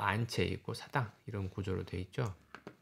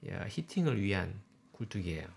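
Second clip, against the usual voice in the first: ordinary talk, nothing marked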